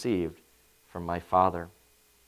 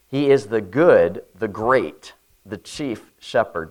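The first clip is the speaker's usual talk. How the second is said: ordinary talk, nothing marked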